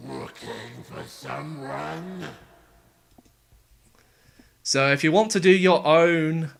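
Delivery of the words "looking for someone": layered demon voice. Shown as a demonstration. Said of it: "a similar process to how it was actually done".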